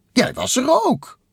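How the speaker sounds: very high-pitched cartoon character voice; Takel. A narrator's reading. Takel reminding Bliksem he was there, high and insistent.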